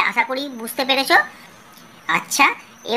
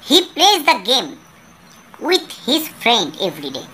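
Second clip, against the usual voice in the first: slow reading aloud; much slower than usual. Shown as a demonstration. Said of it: "teacher reads English passage aloud".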